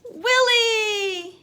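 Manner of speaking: loud, high excited cry; joyful cry. A narrator's reading. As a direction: cheer as the dog appears. Cheering the dog's arrival.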